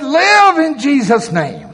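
high, slow, forceful order; acted high-pitched faith-healer cry; insistent command. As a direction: command the dead to live. Voicing Smith's command to corpse.

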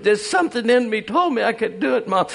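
high, earnest little chick voice; chick answering mother. Acted. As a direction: plead your case to mom as a small chick.

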